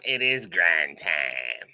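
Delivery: low, slow, drawn-out proclamation; deep catchphrase echo. Pretend. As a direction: drop low and stretch each word out. Hammed echo of viewer's catchphrase.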